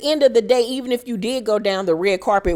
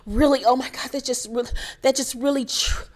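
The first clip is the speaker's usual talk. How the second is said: higher, dramatic imitation of Dorit, breathy panic; mocking Dorit; 5 semitones higher than usual. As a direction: ham up Dorit's overdramatic panic. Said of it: "here go Dorit doing the most".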